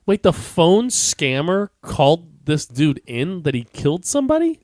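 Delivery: high, drawn-out disbelieving question; incredulous. Real, not acted. Co-host reacts to the story's twist, incredulous. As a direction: slowly climb way up in real astonishment.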